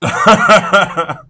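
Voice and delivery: loud real laugh mid-sentence; sudden loud laugh while telling the story; burst of laughter. Real, not acted. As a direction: crack up laughing.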